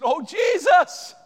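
high, drawn-out shout of appeal; loud, anguished call; desperate cry. Acted. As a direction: cry out as a pleading mother. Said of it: "I think she said".

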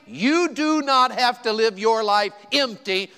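slow, loud, driving sermon delivery; emphatic preaching. Real, not acted. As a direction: preach it out, stretching each phrase. Preaching at full force.

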